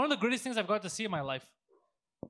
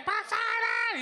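very high mock shout; imitated yelling; 13 semitones higher, 4 dB louder, much slower than usual. Friend imitates mom calling her son from the car, yelling. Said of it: "She starts yelling".

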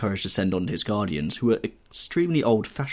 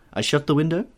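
lightly voiced valet, pitched higher; valet's question; 6 semitones higher, 3 dB louder than usual. A narrator's reading. Valet offering to shut the window, lightly voiced question. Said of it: "asked his valet".

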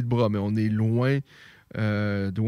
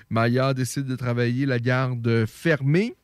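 usual speaking voice

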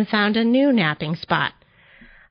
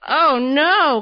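raised, louder storybook dismay; cried out; 6 semitones higher, 6 dB louder than usual. A narrator's reading. Tortoise crying out as he tumbles, dismayed. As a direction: cry out in mock alarm. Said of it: "cried Tortoise".

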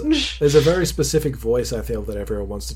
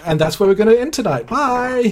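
cheeky sign-off; 10 semitones higher, 7 dB louder than usual. Retold or imitated. DM ends on a cliffhanger.

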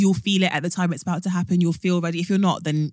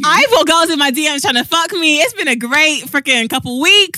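louder, higher gleeful brag; excited brag; 9 semitones higher, 7 dB louder than usual. Real, not acted. Guest thrilled about new female fans, laughing. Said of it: "excited, laughing sign-off".

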